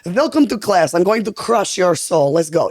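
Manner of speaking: slow, stern Russian fitness trainer; Russian accent. Acted. Russian fitness trainer greeting the class, menacing Russian accent. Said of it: "And the Russian would be like".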